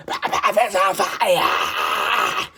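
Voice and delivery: slow, drawn-out wail. Real, not acted. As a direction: stretch each word out in a wail.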